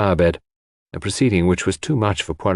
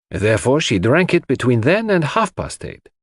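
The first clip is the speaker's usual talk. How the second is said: put-on higher-pitched, animated accented detective; Poirot, reasoning; 6 semitones higher than usual. A narrator's reading. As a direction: reason it through emphatically, point by point.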